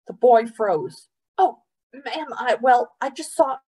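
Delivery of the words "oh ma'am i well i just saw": put-on high, halting young boy's voice; flustered boy. A narrator's reading. Boy froze before angry mother, flustered and halting. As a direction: stammer out an excuse to an adult.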